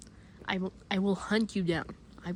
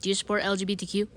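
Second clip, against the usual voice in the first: plain quick question; 3 semitones lower, much faster than usual. Acted. First quick asking.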